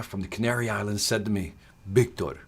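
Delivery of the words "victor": put-on low Spanish-accented calling of a name; deep Spanish-accented address; Jose Santana's Spanish accent. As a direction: imitate the mentor's Spanish accent. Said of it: "in that voice, that tone".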